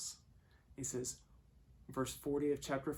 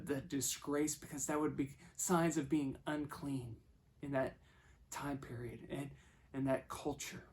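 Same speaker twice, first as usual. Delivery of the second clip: normal talking voice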